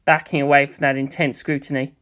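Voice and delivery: usual speaking voice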